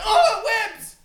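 loud high laughing yell; shouting match. Acted. Heated mock argument over Batman.

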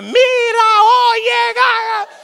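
hammed-up abuela rushing out in a fury; outraged Puerto Rican grandma. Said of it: "she came outside and she like".

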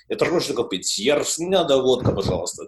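Russian spoken with a neat Moscow accent; tidy Moscow-accented Russian; nice Moscow accent. Shown as a demonstration. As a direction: put on your best Moscow Russian. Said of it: "from a nice Moscow accent".

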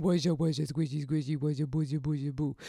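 lightly voiced nonsense coo to a puppy; a hint of sing-song distraction noises; silly baby talk. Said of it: "on its head going".

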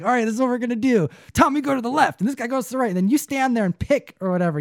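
high, fast mock coach play call; lightly voiced youth coach drawing up a play; imitating a coach. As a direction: rattle off the play like an eager coach.

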